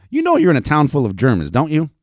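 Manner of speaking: high, loud reproof; scolding. A narrator's reading. Madam unfooled by a newcomer's lie, scolding. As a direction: keep telling him off. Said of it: "Betty scolded".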